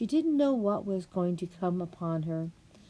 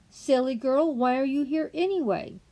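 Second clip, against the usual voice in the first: scolding rabbit; 7 semitones higher, 5 dB louder than usual. Acted.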